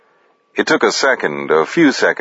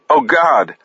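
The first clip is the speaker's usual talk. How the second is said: terrified cry; 8 semitones higher than usual. A narrator's reading. Paris cornered at gunpoint.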